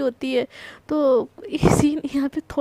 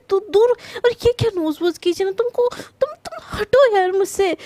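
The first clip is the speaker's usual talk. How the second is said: higher, louder irritated push-away; flustered annoyance; 5 semitones higher, 4 dB louder than usual. Acted. Krishna snapping at clingy Prachi, flustered and loud. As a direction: shove her off with embarrassed irritation.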